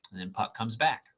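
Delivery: plain reading voice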